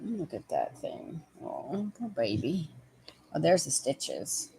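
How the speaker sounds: soft, slow pity; tender cooing. Real, not acted. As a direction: coo gently over a hurt pet. Cooing over injured dog.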